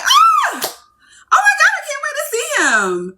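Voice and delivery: high-pitched giddy squeal; giddy tone change. Acted. Woman getting ready for a date, giddy squeal. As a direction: gush in a very high, girlish-crush voice. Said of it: "look how my tone changed".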